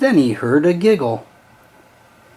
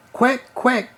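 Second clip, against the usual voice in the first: higher, lightly quacked duck call; a small duck crying out, a bit higher; 8 semitones higher than usual. A narrator's reading. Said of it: "cried another".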